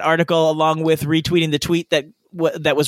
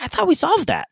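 high, innocent surprise; naive high voice; 10 semitones higher than usual. Retold or imitated. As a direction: play it wide-eyed and naive. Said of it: "Michael's like".

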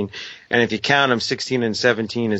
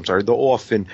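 New York accent; faster than usual. Shown as a demonstration. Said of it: "I say that with my New York accent".

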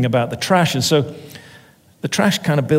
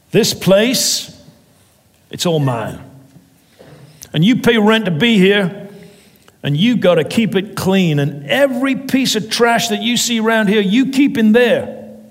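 angry landlord; 7 semitones higher than usual. Acted. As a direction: rant at the tenant. Landlord ranting at tenant.

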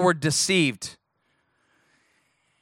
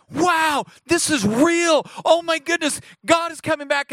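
awestruck; 11 semitones higher than usual. Retold or imitated. The pastor recalls believing the video, very high and awestruck. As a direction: sound stunned that it is real. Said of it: "I was like".

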